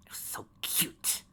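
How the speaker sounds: slow soft whisper. Acted. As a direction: breathe it out, slow. Whispered creepy aside.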